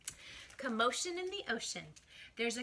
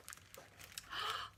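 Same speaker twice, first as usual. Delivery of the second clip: gasp; 10 dB quieter than usual. Pretend.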